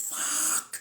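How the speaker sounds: breathy voiceless swear; whispered curse. Acted. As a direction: mouth the swear on just air. Whispered then screamed curse.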